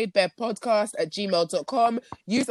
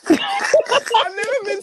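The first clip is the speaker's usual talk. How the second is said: high laughing rant; 11 semitones higher, 4 dB louder than usual. Real, not acted. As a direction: laugh through mock outrage. Retells being angry, laughing.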